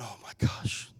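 whispered, sinking reaction; hushed dismay. Retold or imitated. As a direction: breathe it out low, imitating a dismayed friend. Friend reacts with dread to release.

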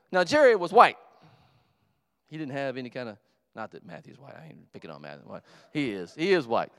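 ordinary talk, nothing marked

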